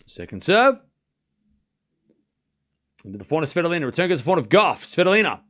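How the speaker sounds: brisk running commentary, rising at each name; live play-by-play. Acted. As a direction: call the rally as it happens.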